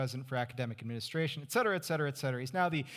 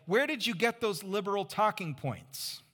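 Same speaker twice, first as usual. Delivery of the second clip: indignant churchgoer; 7 semitones higher, 3 dB louder than usual. Retold or imitated. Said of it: "come up to them after and say".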